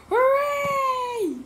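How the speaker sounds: put-on long high cheer from a crowd of kids. A narrator's reading. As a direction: cheer loud and high like happy children. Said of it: "cried the children".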